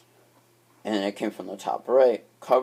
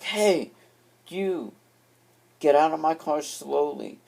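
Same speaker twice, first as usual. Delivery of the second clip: calling out; 6 semitones higher than usual. Retold or imitated. Confronting stranger in car.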